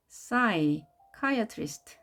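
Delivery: psychiatrist in two parts, "sai", pause, "kaiatrist"; split pronunciation. Shown as a demonstration. Teacher shows learners how to split psychiatrist, pausing after "sai". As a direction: break psychiatrist after the first syllable, slowly. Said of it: "나눠서 발음하시고요".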